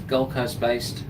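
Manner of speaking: ordinary talk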